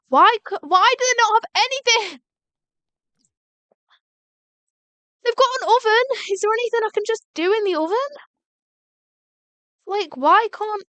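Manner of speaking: high, loud exasperated complaining; fed up, ranting at the game; irritated. Real, not acted. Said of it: "This is pissing me off".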